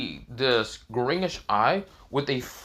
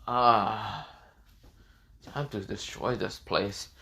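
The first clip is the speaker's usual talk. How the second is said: slow, soft, ominous character voice; understated menace from a powered-up hero; transformed hero; 3 semitones lower, 5 dB quieter, slower than usual. Acted. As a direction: let the threat out slowly and quietly. Transformed hero announces destruction.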